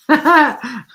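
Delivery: a burst of high laughter; loud laugh. Real, not acted. Amused streamer laughing at her bumper sticker. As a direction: laugh out loud at your own joke.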